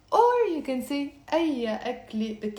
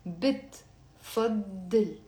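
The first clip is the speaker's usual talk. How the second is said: slow; much slower than usual. Shown as a demonstration. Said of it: "teacher repeats word slowly".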